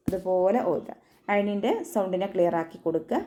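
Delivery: usual speaking voice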